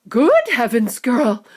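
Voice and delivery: mildly alarmed mother's cry; shrieking mother. Acted. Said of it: "shrieked Missus Beauregard".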